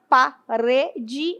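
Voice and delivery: "parede" syllable by syllable, strong "re"; stress on penultimate syllable. Shown as a demonstration. Teacher explaining a paroxytone, syllabified "parede" stressed on "re". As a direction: break up "parede" to show pa, RE, de. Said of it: "é a penúltima sílaba forte".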